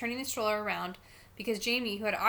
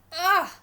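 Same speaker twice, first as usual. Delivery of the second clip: loud, high groan of dislike; exasperated kid's "ugh"; disgusted "ugh"; 8 semitones higher, 10 dB louder than usual. Acted. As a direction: groan at an unwelcome name. Boy disgusted by classmate's name.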